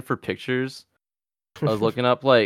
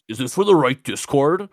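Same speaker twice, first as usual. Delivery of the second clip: higher, louder, talking down; condescending; 5 semitones higher, 8 dB louder than usual. Shown as a demonstration. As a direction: ask it like a smug know-it-all. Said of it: "But then I feel like an asshole 'cause I'm like".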